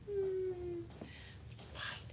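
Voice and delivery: breathy whispered mock self-pity; whispered it's fine. Sulking to herself that it's fine, whispered.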